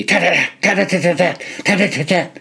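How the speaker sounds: high, rapid-fire gun chatter; machine-gun imitation. A narrator's reading. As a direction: rattle off quick staccato gun bursts.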